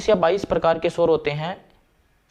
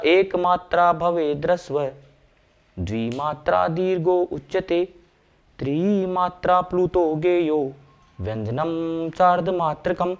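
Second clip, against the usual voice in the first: verse recitation; slower than usual. Acted.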